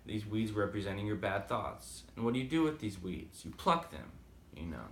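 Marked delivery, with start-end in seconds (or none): none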